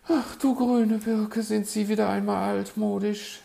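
high, loud, exasperated bird lady; put-on high voice, put out; sighing magpie. A narrator's reading. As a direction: sigh it out in a piping voice. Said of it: "seufzte die Elster".

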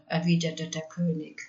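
usual speaking voice

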